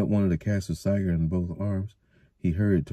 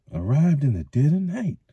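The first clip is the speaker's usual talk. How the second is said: disapproving; 8 semitones higher, 4 dB louder than usual. Acted. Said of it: "shook his head in disapproval".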